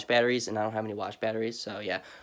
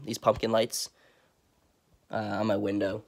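ordinary talk

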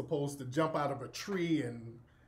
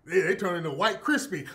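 ordinary talk, nothing marked

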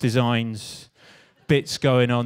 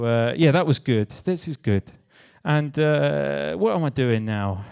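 usual speaking voice

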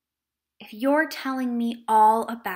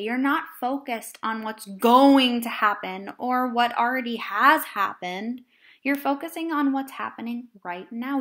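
ordinary delivery

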